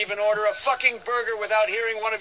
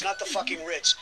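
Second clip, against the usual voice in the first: ordinary talk, nothing marked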